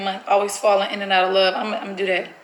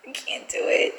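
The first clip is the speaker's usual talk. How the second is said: anxious whisper; 5 dB quieter than usual. Real, not acted. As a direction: drop to a scared whisper. Panicking over the prank.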